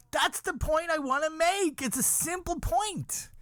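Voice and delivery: squealed, worked-up rant; high-pitched exasperation. Acted. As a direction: make the point in a very high, strained pitch. Rant shouted straight out of wailing.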